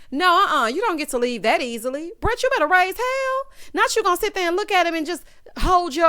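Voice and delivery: loud, high, insistent urging; fired-up indignation. Real, not acted. Urging Brett to raise hell, loud and indignant. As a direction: holler at her to stand up for herself.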